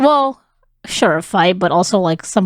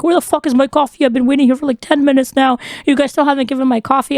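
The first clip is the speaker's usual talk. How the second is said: mock yelling customer, higher and fast; retold angry yell; 6 semitones higher, faster than usual. Coworker retells a customer yelling about his coffee, in a mock angry yell. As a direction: fire off the rude customer's complaint quickly. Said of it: "This one guy starts yelling at me".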